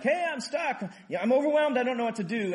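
high, loud overwhelmed cry for help; stuck in a hole. Retold or imitated. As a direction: call up from the hole, panicking.

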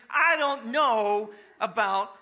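hesitant dodge. Retold or imitated. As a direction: stretch it out like you've been caught. Teen caught out.

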